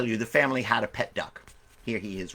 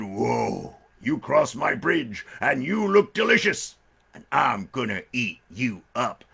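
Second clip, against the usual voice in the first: slow, drawn-out menacing bear; big storybook bear, very slow; hungry bear; slower than usual. Acted. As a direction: savour each word like a hungry bear.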